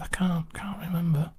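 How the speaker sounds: whispered, barely there reply; sheepish whisper to the doctor. Retold or imitated. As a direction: breathe it out as a whisper. Retold reply to odd question.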